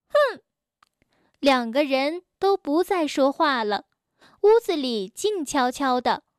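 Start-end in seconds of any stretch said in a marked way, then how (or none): 0.1-0.4 高高的一声哼; 赌气地哼一声; 生气的哼. Acted. 生气地哼. (吵完架赌气高声一哼)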